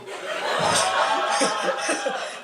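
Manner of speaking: airy giggle high up; real laugh; high breathless laugh. Real, not acted. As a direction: break into a wheezy laugh. Comic laughing at own bit.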